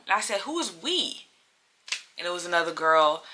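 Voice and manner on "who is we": high, soft incredulous question; retold suspicious challenge; pointed disbelief. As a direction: question it sharply, pitch swooping up.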